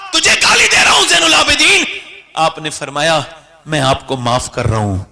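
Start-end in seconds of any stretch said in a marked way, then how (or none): 0.1-1.8 furious shout. Acted. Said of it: "گریبان پکڑ کے کہا". 3.7-5.0 gentle forgiveness. Acted. Imam forgives his abuser.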